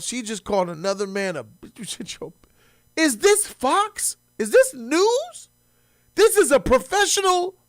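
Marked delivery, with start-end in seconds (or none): 3.0-5.5 high, slow, stunned questions; can't believe what he heard; incredulous. Real, not acted. Outraged at the clip. 6.2-7.6 high-pitched indignation; indignant. Real, not acted. Outraged at the clip.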